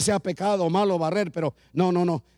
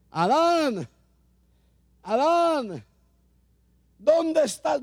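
high, louder calling voice, with Adán drawn out; 8 semitones higher, 4 dB louder, much slower than usual. Retold or imitated. God calling to Adam in the garden, a raised searching call. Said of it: "y grita".

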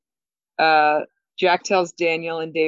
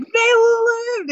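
high, loud burst of joy; overjoyed cry; 7 dB louder than usual. Retold or imitated. Daniel seeing the beam from the sub, a high, loud joyful cry. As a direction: shout it like you just learned your friends survived.

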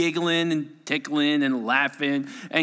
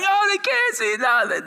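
much higher, louder gloating; gleeful; 4 dB louder than usual. Retold or imitated. Counselor gloating over the blinded campers, gleeful and high. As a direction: crow over the blinded kids.

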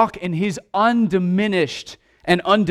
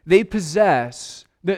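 usual speaking voice

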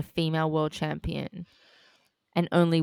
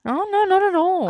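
surprised; 14 semitones higher than usual. Real, not acted. Strongly disagreeing, high pitched.

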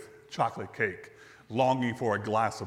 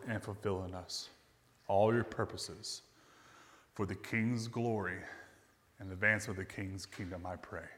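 prayer; 4 semitones lower than usual. Acted. Closing prayer.

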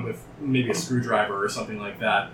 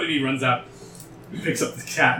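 ordinary talk

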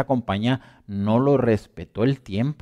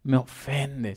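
offended churchgoer; 3 semitones higher than usual. Retold or imitated. Preacher mocking the offended listener, in a light whiny voice. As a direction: lightly mimic a touchy believer taking offense. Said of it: "Van a sentirse ofendidos".

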